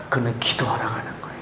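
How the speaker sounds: whispered, hushed and quick. Acted. Preacher whispering to the congregation about Hannah praying. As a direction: lean in and whisper it.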